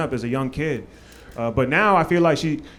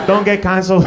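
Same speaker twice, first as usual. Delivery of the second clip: loud, higher mock plea; praying mom; 4 semitones higher, 8 dB louder than usual. Mom praying he stays uncanceled, loud mock plea. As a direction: plead like a praying immigrant mother. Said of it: "My mom in the corner praying for him".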